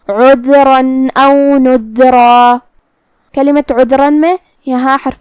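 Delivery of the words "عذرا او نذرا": slow tajweed chant with drawn-out vowels; Quranic recitation. Shown as a demonstration. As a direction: recite the verse in a measured, sustained tone. Recites verse as demonstration.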